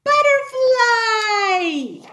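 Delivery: loud, very slow "Butterfly"; drawn-out word. Shown as a demonstration. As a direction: stretch "Butterfly" out for the kids.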